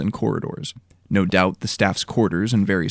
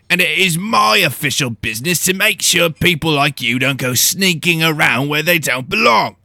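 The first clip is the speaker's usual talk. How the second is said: louder, growling big-woman voice; stern house steward growling a challenge; 8 semitones higher, 6 dB louder than usual. Acted. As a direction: growl at the intruders.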